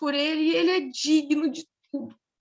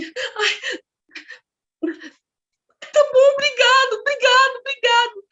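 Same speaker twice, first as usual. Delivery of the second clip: very high, loud, choked-up thanks; overcome with emotion; 11 semitones higher, 7 dB louder than usual. Retold or imitated. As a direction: imitate a big man gushing repeated gratitude. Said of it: "ele ficou assim".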